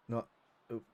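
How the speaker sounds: ordinary talk, nothing marked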